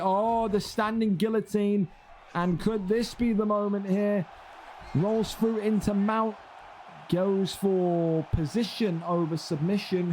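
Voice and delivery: high, rising, slow excited call of the submission; excited play-by-play. Acted. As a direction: build the call as the choke sinks in. Guillotine scramble, excited call.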